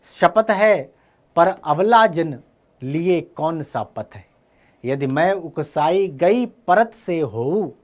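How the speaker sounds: slow, measured verse reading; declaimed poetry, drawn-out words; poem recitation. Acted. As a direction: recite the verse with weighted pauses. Recites the poem being taught.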